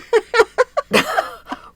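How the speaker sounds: high loud burst of laughter. Real, not acted. Cohost laughing at her own silly noise, high laugh.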